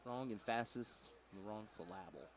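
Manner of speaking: soft echo, emphasis as em-FASS-is, syllable as suh-LAB-ul; wrong-syllable stress. Retold or imitated. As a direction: quietly repeat the joke, middle stress on emphasis and syllable. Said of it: "Put the emphasis on the wrong syllable".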